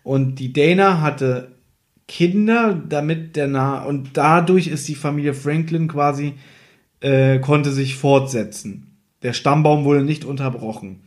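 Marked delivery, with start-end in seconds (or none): none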